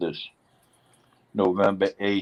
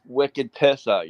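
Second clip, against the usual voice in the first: put-on Boston accent; 5 semitones higher, 5 dB louder, slower than usual.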